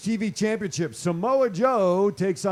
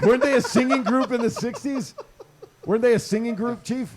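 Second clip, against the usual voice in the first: laughing; 6 semitones higher, 5 dB louder than usual. Real, not acted. Joking question while laughing.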